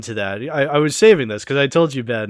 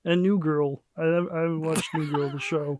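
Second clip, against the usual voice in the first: lightly held puppet-frog voice; Kermit the Frog voice; 2 semitones higher than usual. Acted. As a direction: keep a hint of the frog character. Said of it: "stop doing the current voice".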